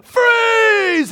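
high, loud, stretched-out yell; shouted command. Acted. PE teacher when things get out of hand, shouted command. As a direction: holler it across the gym. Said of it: "I just go".

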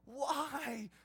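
loud anguished cry of why; shouting at God. Pretend. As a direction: hurl it up at the sky. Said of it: "you shake your fist at God and go".

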